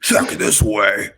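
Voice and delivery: slow, lightly Bane-like henchman; lightly voiced dim henchman goon; bad Bane voice. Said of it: "that's my goon voice".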